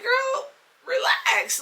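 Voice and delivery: very high, drawn-out protest; slow, squeaky-high mock plea; exasperated plea. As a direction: stretch it out high to calm her. Acted telling Becky to relax.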